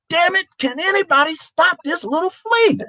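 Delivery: very high-pitched mock yell; retold coach meltdown. Coach yelling at players who can't stop a walk-on, mock meltdown. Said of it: "He's yelling at the, at the scholarship players".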